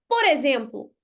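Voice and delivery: ordinary talk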